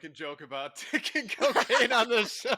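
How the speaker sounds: high, loud, laughing through words. Real, not acted. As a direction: barely get it out while laughing. Laughing hard.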